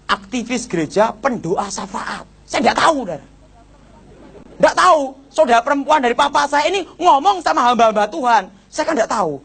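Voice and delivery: ordinary talk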